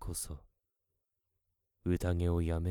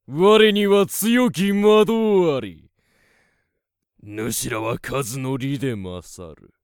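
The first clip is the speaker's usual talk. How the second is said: loud, high-pitched taunting sorcerer; booming commanding warlord; 8 semitones higher, 13 dB louder, slower than usual. Acted. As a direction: roar defiance at the horde. Wizard taunts monster army.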